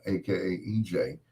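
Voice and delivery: normal talking voice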